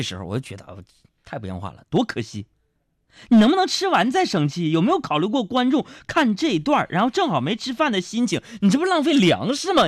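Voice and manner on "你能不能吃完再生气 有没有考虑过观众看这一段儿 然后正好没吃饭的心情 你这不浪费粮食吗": high-pitched exasperated rant; mock scolding. Comedian berating a film lead for wasting food, high mock-indignant rant. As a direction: scold them in comic outrage.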